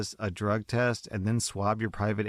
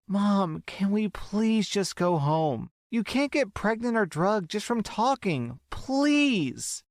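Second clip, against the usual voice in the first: much higher, slow desperate plea; pleading girl; 11 semitones higher, slower than usual. Acted. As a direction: beg her mother once more. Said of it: "The girl is pleading to her mother".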